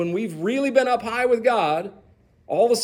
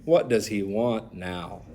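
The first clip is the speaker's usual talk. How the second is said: much lower, softer and slow, fed up; grumbling; 11 semitones lower, 7 dB quieter, slower than usual. Retold or imitated. Isaac grumbling to himself at the visitors, low and slow. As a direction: sigh it out under your breath, annoyed. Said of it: "I'm thinking".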